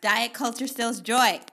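loud emphatic declaration; repeated loudly for the crowd. Shown as a demonstration. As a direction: proclaim it loud for the people in the back. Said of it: "Say that one more time for the people in the back".

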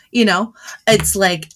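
normal talking voice

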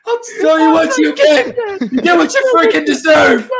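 Joker rant. Acted. Quoting Joker scene, louder.

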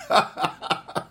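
soft, breathy run of chuckles; amused laugh. Real, not acted. Preacher recalling grandmother catching the kids cussing, real laughter. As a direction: laugh helplessly at the memory.

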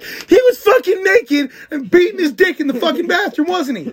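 high, cracking with laughter; laughing disbelief. Retold or imitated. Telling friends about the naked man, laughing high. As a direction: squeal it through laughter. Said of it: "I'm like".

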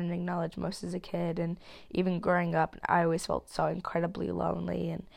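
ordinary talk

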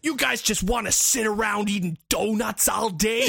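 shouted parody of a TV detective; hammed-up cop berating his lazy squad; cliched American cop. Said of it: "American cop cliches".

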